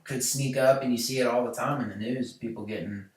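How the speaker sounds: ordinary talk, nothing marked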